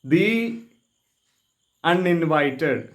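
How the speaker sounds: ദി with a long ee, then a slow അൺഇൻവൈറ്റഡ്; "thee" before vowel. Shown as a demonstration. Teacher answers own question for learners, slow ദി before അൺഇൻവൈറ്റഡ്. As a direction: say ദി as "thee", pause, draw out അൺഇൻവൈറ്റഡ്. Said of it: "അതുകൊണ്ട് നമ്മൾ എന്ത് പറയും".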